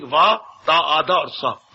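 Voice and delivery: "वा, ता, आधा और सा" slowly, letter by letter; spelling out. Shown as a demonstration. Preacher spells the goddess's word for listeners, slowly spelling out. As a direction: sound out each piece of "वा, ता, आधा और सा" separately. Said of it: "वत्स का शब्द सुना दिया".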